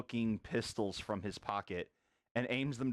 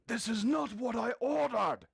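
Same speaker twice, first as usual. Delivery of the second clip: angry shouting patron; 11 semitones higher than usual. Acted. Patron shouts at the staff about his wrong order, angry and high. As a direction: yell it across the restaurant, indignant.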